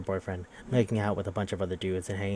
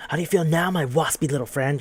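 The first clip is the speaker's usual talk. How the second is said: higher, louder mock-menacing taunt; acted gloating; 6 semitones higher, 5 dB louder than usual. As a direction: taunt a beaten rival with hammed-up glee. Bully gloating, grabbing victim's neck.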